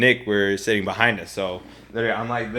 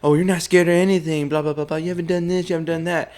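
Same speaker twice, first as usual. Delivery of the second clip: mocking other people; 6 semitones higher than usual. Rider quoting people who think he's fearless, high mocking mimicry. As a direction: imitate chattering doubters in a raised pitch. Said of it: "'Cause people are like".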